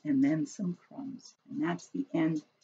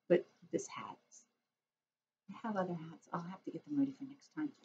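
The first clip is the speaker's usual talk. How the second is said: ordinary delivery, nothing marked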